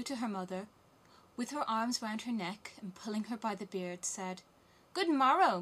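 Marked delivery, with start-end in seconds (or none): 4.9-5.6 high, loud morning hail; loud, boisterous little girl's hello; hearty greeting. A narrator's reading. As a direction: sing out a greeting to your mother. Robber girl greets her mother.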